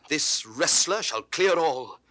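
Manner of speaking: determined threat. Acted. Villain's resolve.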